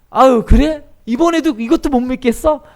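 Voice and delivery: high, loud questioning; mock exasperated surprise. God imagined answering doubting Gideon, high exasperated questioning. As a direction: react loudly, as if taken aback.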